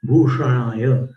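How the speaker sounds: "भूषणाय" with the tongue curled up on ष; "भूषणाय" with a retroflex ष, said slowly. Shown as a demonstration. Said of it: "जीभ को ऊपर करेंगे।".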